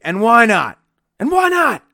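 high, loud wrestler's taunt; imitating a wrestler's soaring promo cry; undulating Flair voice. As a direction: throw it up high and loud, repeating the challenge.

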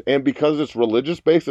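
ordinary delivery

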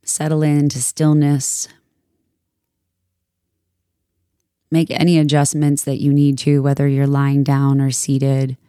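calm, measured meditation voice with long pauses; soothing yoga teacher guiding a meditation; guided meditation. Acted. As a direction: ease listeners into stillness, unhurried and even.

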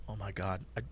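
very soft, low, weary mutter; exasperated mutter. Retold or imitated. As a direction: sigh it under your breath, fed up. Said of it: "I'm just like".

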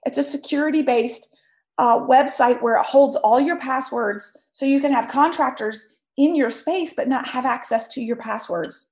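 ordinary talk, nothing marked

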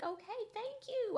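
high-pitched, drawn appreciation; pleased relief. Retold or imitated. Viewer relieved [name] sang well, high pleased thanks. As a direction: say it up high, gratefully. Retold relieved reaction to good singing.

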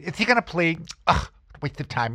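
high, put-on voice, fed-up groan; bored DA who finds it all a waste of time; impatient prosecutor. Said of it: "and of course the same attitude".

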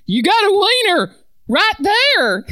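put-on loud, high Southern accent. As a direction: push it in a heavy Southern accent. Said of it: "The woman was quick to follow up".

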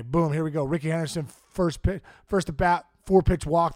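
usual speaking voice

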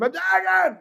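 high shrieking "Objection!"; screamed objection. Retold or imitated. Lawyer knocking things over, screaming objection. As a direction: yell it like the sky is falling.

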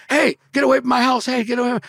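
high, loud shooing shout; high-pitched retold holler; imitated yelling lady. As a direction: yell like a woman chasing off a trespasser.

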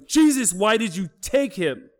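a mild mock wail of a grieving mother; retold mother's scream. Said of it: "Mom is screaming".

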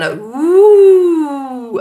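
slow, high, loud ghostly ooh. Shown as a demonstration. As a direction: hoot like a ghost. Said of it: "a ghostly ooh sound".

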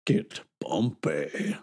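low, slow announcer call. Acted. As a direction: draw it out like a fight announcer. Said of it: "announcer voice".

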